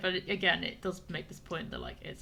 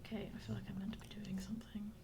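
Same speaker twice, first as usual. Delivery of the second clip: whispering; 8 dB quieter than usual. Real, not acted. Whispering to herself while playing.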